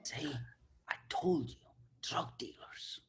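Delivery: hushed unvoiced aside; nervous whisper to a friend. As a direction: whisper it to your buddy.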